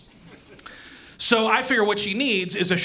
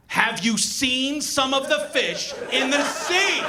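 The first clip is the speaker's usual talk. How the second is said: mock horror; 7 semitones higher, slower than usual. Mock horror at sea creatures.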